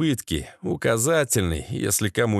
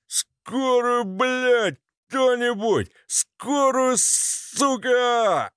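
high, drawn-out desperate pleading; pained cry for help; 11 semitones higher, much slower than usual. Acted. Wounded Slavik begs for an ambulance, high agonised wail.

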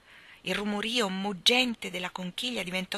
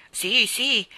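louder, raised, reassuring yes; brave; 4 semitones higher, 8 dB louder than usual. A narrator's reading. Michael reassures his sister, bravely. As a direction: put a brave face on it. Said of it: "egli disse coraggiosamente".

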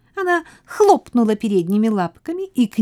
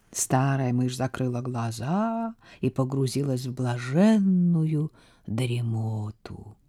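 slow, much softer, fading away; drowsy fade; 6 semitones lower, 6 dB quieter, slower than usual. A narrator's reading. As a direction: let the voice drift off to sleep. Narration fading into sleep.

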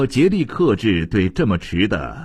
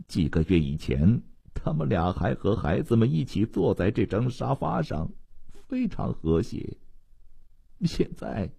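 hushed, resigned recollection; subdued lament; 9 dB quieter than usual. A narrator's reading. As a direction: let the words sink away quietly, with heavy pauses. Worried mother's lament voiced in narration.

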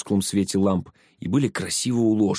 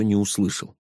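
usual speaking voice

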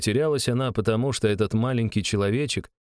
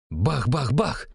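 knocking noise; 5 semitones higher, much slower than usual. A narrator's reading. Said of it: "постучала по перегородке".

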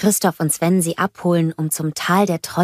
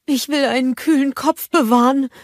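higher, put-on voice for Gerda; Gerda character voice; 8 semitones higher, 3 dB louder than usual. A narrator's reading. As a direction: play Gerda with her head in the icebox.

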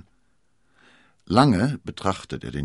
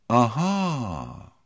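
knowing aha; 5 semitones higher than usual. Acted. Cardinal spots D'Artagnan waiting at his tent, knowing. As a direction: let it swell and fall knowingly.

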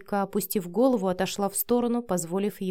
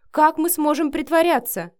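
high, louder, distressed question; desperate protest; 8 semitones higher, 7 dB louder than usual. A narrator's reading. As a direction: protest with the voice raised. Acted protest in a quarrel. (в отчаянии, повышенным голосом)

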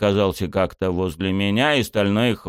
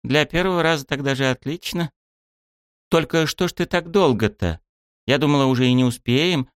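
lightly voiced woman, higher pitch; club head; 7 semitones higher than usual. A narrator's reading. Club head chatting over photos, lightly higher voice.

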